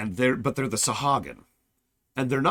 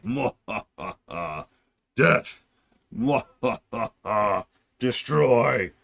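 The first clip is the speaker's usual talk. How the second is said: hammed-up evil cackle and threat; mock cartoon villain gloating; cackling evil villain; 3 dB louder, slower than usual. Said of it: "they're cacklingly evil".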